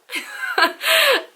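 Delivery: high burst of laughter. Real, not acted. Laughs after quoting the girl.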